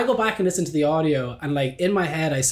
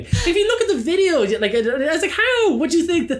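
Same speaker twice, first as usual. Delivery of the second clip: very high, squeaky with laughter; high giggling rant; laughing incredulity; 13 semitones higher, 4 dB louder than usual. Real, not acted. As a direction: squeal through laughter in disbelief.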